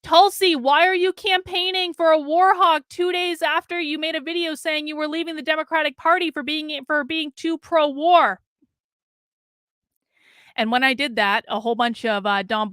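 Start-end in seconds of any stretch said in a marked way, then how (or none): 0.0-8.4 high, raised-voice heckle; retold shout, pitched up; reenacted shout. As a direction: call out an accusing question across a room. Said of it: "I may have shouted at her".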